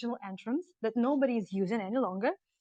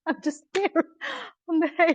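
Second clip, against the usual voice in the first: excited; 8 semitones higher, 5 dB louder than usual. Real, not acted. Sitting on hay, delighted.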